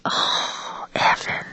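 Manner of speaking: breathy unvoiced hush; whispering the child's name, shocked; dismayed whisper. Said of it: "I'm just like".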